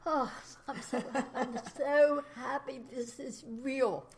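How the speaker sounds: high, slow, moved to tears; slow and tearful with joy; teary. Real, not acted. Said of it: "I'm starting to sound teary".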